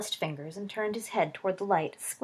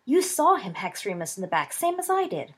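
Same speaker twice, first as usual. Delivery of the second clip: loud, higher furious outburst; angry outburst; 8 semitones higher, 9 dB louder than usual. Acted. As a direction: explode at him in anger. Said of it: "Sirius was incensed".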